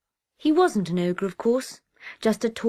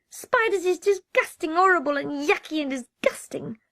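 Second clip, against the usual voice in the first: put-on high, loud child voice full of disgust; a small boy ranting about spiders; high childish rant, ending in a whisper; disgusted little boy; 8 semitones higher, 5 dB louder than usual. Reader voices the small boy Nick.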